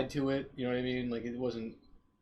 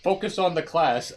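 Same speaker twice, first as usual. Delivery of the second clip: raised, louder classroom command; mock insistent teacher; 5 semitones higher, 9 dB louder than usual. Teacher to pupils distracted by the window, mock stern and loud. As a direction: imitate a teacher demanding focus. Playing the teacher scolding the students.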